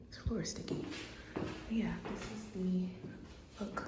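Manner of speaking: hushed whisper to the camera; quiet aside to viewers. Real, not acted. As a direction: whisper so nobody around hears. Filming quietly in a public place.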